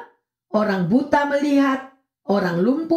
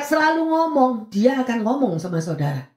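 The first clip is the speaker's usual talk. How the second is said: ordinary talk, nothing marked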